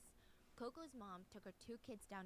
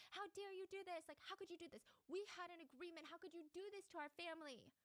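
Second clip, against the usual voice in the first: 높고 화난 흉내 고함; 이웃집에 따지듯 흉내 낸 분노의 외침; retold angry scream; 8 semitones higher than usual. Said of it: "she would scream at them".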